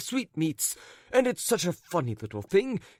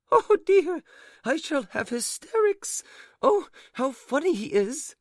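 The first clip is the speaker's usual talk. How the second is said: much higher, laughing hysterically; laughing lady; 12 semitones higher, 3 dB louder than usual. A narrator's reading. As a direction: squeal it through giggles. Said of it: "rolling from side to side with laughter".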